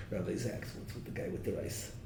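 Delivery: softly mocking bully aside; mock bully whisper. Bullies at a wedding whispering to the rabbi about the rice guy, mock gossip. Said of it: "We're gonna be the bullies".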